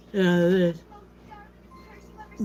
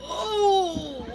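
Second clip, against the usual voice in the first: high, laughing burst of surprise; real surprised laugh; 12 semitones higher than usual. Real, not acted. Child suddenly appears on stream.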